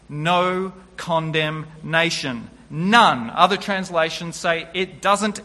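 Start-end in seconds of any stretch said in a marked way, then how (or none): 2.7-3.3 None punched much louder, short and final; emphatic none. Real, not acted. Preacher insists to the congregation there is none, loud and final. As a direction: slam the word None down as the last word.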